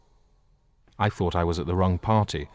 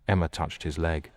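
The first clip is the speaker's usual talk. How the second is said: ordinary delivery, nothing marked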